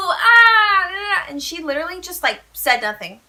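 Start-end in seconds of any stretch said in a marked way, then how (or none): 0.1-1.2 loud, high joyful scream trailing off; elated squealing scream, tailing away. Retold or imitated. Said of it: "I literally screamed".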